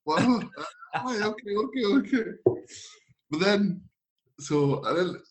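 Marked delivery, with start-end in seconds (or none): 0.0-2.2 high, brushing-off whatever; dismissive. Retold or imitated. Player brushing off the teasing, high and dismissive. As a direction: wave it off, a touch mocking. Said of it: "I was like".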